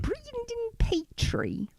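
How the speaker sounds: high, soft, slow British woman; drawn-out squeak of an English accent; Sharon Osbourne. Pretend. Co-host tries the battler's name, high soft British impression. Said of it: "That was Sharon Osbourne".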